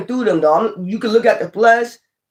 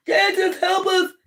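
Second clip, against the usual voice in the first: panicked plea; 11 semitones higher, 4 dB quieter, slower than usual. Retold or imitated. Disciples panicking in storm.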